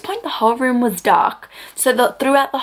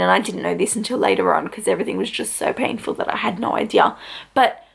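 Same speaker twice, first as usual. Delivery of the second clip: ordinary talk, nothing marked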